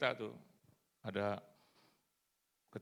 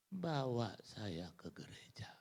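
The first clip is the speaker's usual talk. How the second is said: slow weak whisper of a sick man; slower than usual. Retold or imitated. Sick husband begging his wife, slow weak whisper. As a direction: breathe it out slowly like a bedridden man.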